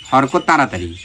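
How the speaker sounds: ordinary talk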